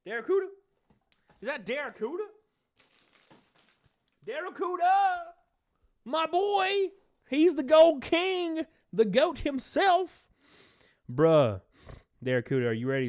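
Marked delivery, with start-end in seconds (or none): 0.1-2.2 high, questioning call; hopeful surprise. Real, not acted. Streamer spotting a viewer in chat, hopeful surprise. 4.3-10.3 very high, drawn-out cheer; excited hype. Real, not acted. Streamer greeting favourite viewer Daracuda, excited hype.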